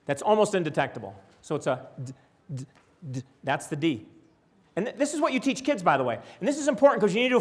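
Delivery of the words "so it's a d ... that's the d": d with a tiny, barely heard vowel; short d demo. Shown as a demonstration. Teacher showing the short d, quiet. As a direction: clip the d with the shortest schwa. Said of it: "a very super short schwa length".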